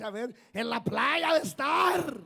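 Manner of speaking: loud, high, drawn-out jibe; sneering accusation. Acted. Critic jeering at the pastor preaching abroad, scornful shout. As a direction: shout the gibe, stretching the end.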